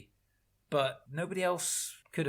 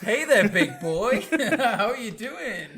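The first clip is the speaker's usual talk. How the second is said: loud, high, slow hammed-up come-on; exaggerated flirty drawl through laughter; mock flirtation; 8 semitones higher, 9 dB louder, slower than usual. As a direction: tease him with an over-the-top seductive greeting.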